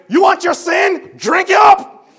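loud, high, slow rebuke; furious prophet scolding the people; angry Moses. Retold or imitated. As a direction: bellow it at the sinners. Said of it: "That's what he told 'em".

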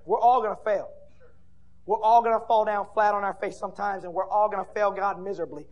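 loud, emphatic preacher warning; forceful preaching. Real, not acted. Preacher warning everyone they will fail, forceful preaching. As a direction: lean hard on each repeated warning.

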